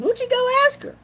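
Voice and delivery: high-pitched imitation of a dad; dad's quote. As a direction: mimic the dad with a high rising question. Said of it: "he said".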